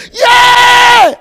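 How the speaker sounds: very high, loud drawn-out yell; acted long cry of alarm; startled shout. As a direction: let out a huge high holler. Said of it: "The man shouted".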